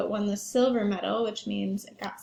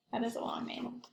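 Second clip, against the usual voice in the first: whisper; 10 dB quieter than usual. Real, not acted. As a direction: drop to a hushed whisper. Whispered aside to kids.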